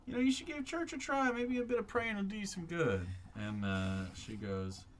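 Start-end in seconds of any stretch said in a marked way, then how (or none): 0.1-3.1 high, slightly louder preachy urging; Cal's voice. Acted. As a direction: nudge her toward church earnestly. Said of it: "Cal goes".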